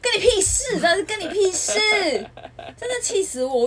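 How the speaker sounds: high, loud outraged outburst; furious rant. Real, not acted. Venting to a friend about gym critics, loud and high-pitched fury.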